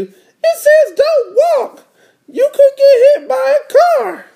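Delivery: very high little-sister warning voice. Acted. Little sister warning her brother off the road, very high voice. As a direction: scold in a squeaky kid voice. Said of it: "said D W".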